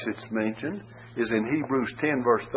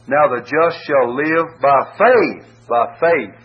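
slow, louder, "faith" falling firmly; stress on faith; 5 dB louder, slower than usual. Shown as a demonstration. Preacher showing the Hebrews emphasis, stressed. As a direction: hammer the word "faith". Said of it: "Faith is in view".

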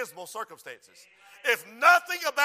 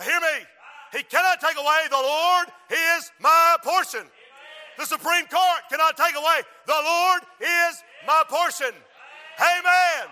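louder, higher preacher's roar; rising pulpit fervor; preaching at full force; 6 semitones higher, 8 dB louder than usual. Acted. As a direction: drive it home to the congregation. Preacher climax on my portion.

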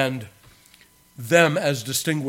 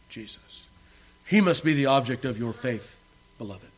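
usual speaking voice

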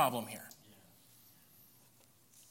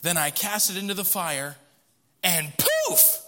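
higher, louder, storytelling read; dramatic scripture reading; 3 semitones higher, 5 dB louder than usual. Acted. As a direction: read Aaron's tale with flair. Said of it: "Verse number twenty-two".

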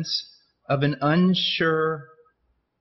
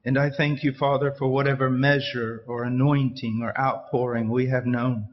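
prayer; 5 semitones lower than usual. Real, not acted. Closing prayer, low and soft.

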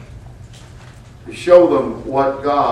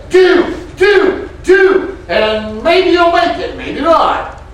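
shouted, much higher, demanding; mocking Pharisees; 13 semitones higher, 5 dB louder, slower than usual. Preacher mocking Pharisees who load a yoke, high shout. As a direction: bark orders like a Pharisee. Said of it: "The Pharisees would ... and say".

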